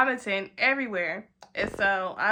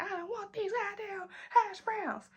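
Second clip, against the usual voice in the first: put-on much higher, softer weepy drunk wail; a tearful squeaky tantrum; drunk crying whine; 9 semitones higher, 8 dB quieter than usual. Refusing hash browns without ketchup, high weepy drunk whine.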